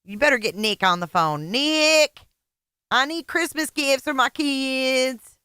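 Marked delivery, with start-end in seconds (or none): 1.5-5.4 mock whiny beggar. Mocking the streamer asking for gifts.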